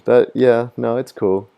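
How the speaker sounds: ordinary delivery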